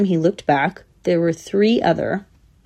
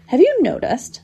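put-on high-pitched old squirrel voice; puzzled old squirrel, high; elderly squirrel; 9 semitones higher than usual. A narrator's reading. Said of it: "said one elderly squirrel".

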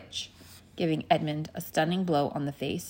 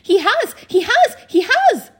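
put-on very high, loud baby squirrel shriek; piercing, repeated little-animal squeal; frantic squeak; 13 dB louder than usual. A narrator's reading. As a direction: squeak it hysterically as a tiny squirrel. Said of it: "it squeaked".

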